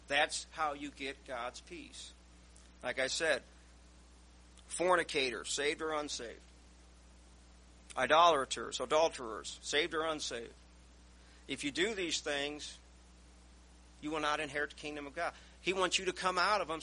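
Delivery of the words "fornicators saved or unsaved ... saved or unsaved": loud, hammering list; preaching. Acted. Preacher warning the congregation about sin, loud and hammering. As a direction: punch each sin at the church. Preacher listing sins emphatically.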